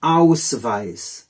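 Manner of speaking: Ausweis with OW and a sharp V, long hissing s at the end; teacher demo of Ausweis; German Ausweis. Shown as a demonstration. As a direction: spell it out with your voice, OWS-vice. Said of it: "they say".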